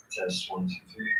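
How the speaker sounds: faint delayed playback of mic test; screen recording. Real, not acted. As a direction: test heard back through laptop. Mic test heard played back.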